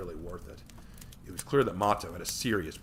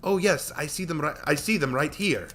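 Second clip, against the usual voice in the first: sarcastic; 7 semitones higher, 6 dB louder than usual. Acted. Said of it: "he answered sarcastically".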